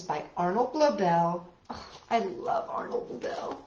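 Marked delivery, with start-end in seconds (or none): none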